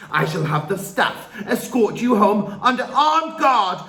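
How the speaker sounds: loud, high, building rage; furious rant. A narrator's reading. Trunchbull ranting at Matilda, threatening expulsion. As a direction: rant her into the ground.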